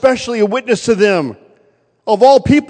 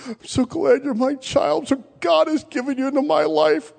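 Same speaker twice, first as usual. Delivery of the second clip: mom crying; 7 semitones higher, 4 dB quieter than usual. Retold or imitated. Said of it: "crying and just kissing on me".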